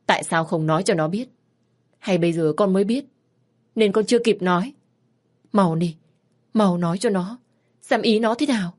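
put-on a frantic mother berating her child; scolding mother. Mother scolding her teenage daughter, loud and distraught.

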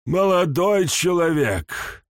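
put-on high, slow scolding old man; reproachful old man. A narrator's reading. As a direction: chide him like an offended elder. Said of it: "с укоризной в глазах произнёс он".

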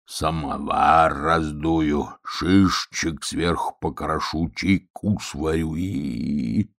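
put-on low, very slow old wolf; dreamy old wolf. Old wolf relishes making tea, slow and low. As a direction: savour each word, drawn out and low.

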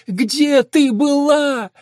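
high, slow, loud wail of reproach; drawn-out, high-pitched hurt demand; hurt child's voice. A narrator's reading. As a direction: cry it out like a wronged child. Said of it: "голос обиженного ребёнка".

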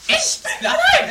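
high, loud gleeful cry with a laugh; giddy heir crowing loudly; excited outburst. Acted. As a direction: burst out in laughing glee. Acted greedy heir exults.

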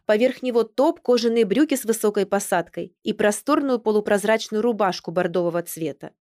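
plain reading voice

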